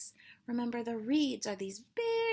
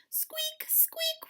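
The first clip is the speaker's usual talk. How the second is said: high squeaky creak of a lantern; lantern squeak. A narrator's reading.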